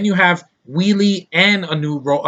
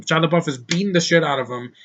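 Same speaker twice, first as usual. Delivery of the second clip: usual speaking voice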